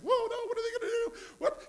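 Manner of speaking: high, loud, fast mock panic; a worried parent flapping over a grown kid; panicky parent. Parent panicking over his grown child's news, high mock alarm. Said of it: "We're freaking out all the time".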